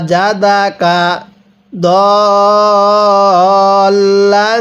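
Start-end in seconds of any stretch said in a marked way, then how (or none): 1.7-3.9 দল with the vowel held four alif counts; long madd on dal. Shown as a demonstration. Teacher showing students দল with a four-count pull. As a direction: stretch the vowel of দল long and steady. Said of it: "দদের মধ্যে চার আলিফ টান দিব".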